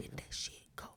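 whispering. Real, not acted. Whispered mantra again.

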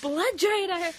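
loud, high put-on voice; hammed-up house elf. Quoting elf through laughter, high mock insult. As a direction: finish the curse in a loud elf voice. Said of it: "He's like".

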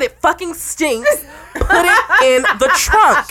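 high, loud, slow command with a laugh. Retold or imitated. Pregnant woman ordering boyfriend's food into the trunk, laughing. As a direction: lay down the law, half laughing.

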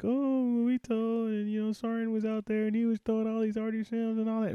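high, whining mock voice of complaining soldiers; whiny mock complaint. Drill sergeant mocking soldiers tattling, high and whiny. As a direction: mimic them whining to the boss.

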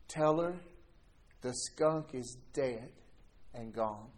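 slow and soft, words falling away; quiet stunned reply. Retold or imitated. Son answers his stepdad, stunned, slow and soft. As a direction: stop in your tracks and say it quietly, slowly.

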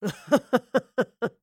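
short scornful laugh; mocking villain laugh. A narrator's reading. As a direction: laugh at her with contempt. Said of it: "Wayland laughed".

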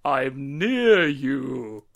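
distant, slow voice off the mic; far from mic. Real, not acted. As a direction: speak from across the room, slowly. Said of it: "you just sound".

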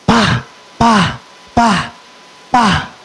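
four sudden bursts mimicking slaps; imitated repeated slaps. Father slaps his son over a report card, imitated smacks. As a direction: run of short vocal smacks. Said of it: "slap me on the head".

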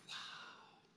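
breathless, voiceless wow of awe; awed whisper. Pretend. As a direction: whisper it as if struck by wonder. Imagined awe at the end.

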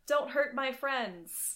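slow, earnest hero plea. Retold or imitated. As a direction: play the sincere generic hero, slowly. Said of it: "He's very".

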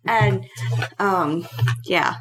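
ordinary delivery

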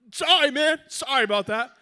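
loud, high-pitched doofus apology; mock apology. Teammate apologizing after friendly fire, loud mock-sheepish voice. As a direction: blurt a hammed-up sorry.

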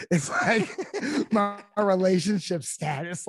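laughing through the words, high and loud. Real, not acted. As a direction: laugh it out, amused at the question. Laughing at the awkward question.

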